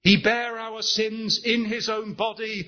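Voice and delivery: high, loud, slowing preacher's climax; soaring pulpit voice, stretched for emphasis; fervent preaching. Acted. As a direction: drive the sermon home at full force.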